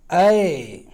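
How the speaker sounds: ऐ held long and loud; a loud long Angika ऐ; drawn-out Angika ai. Shown as a demonstration. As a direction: stretch the ऐ out. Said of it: "इसको टानना होगा".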